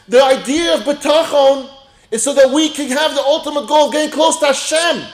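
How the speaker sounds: high, loud, driving sermon delivery; impassioned preaching. Real, not acted. Rabbi drawing the lesson from his story, impassioned preaching.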